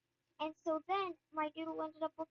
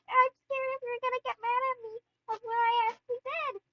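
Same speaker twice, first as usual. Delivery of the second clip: high, loud pleading; acted-out anxious whine, raised in pitch; retold scared confession; 8 semitones higher, 9 dB louder than usual. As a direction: plead as if about to be in trouble.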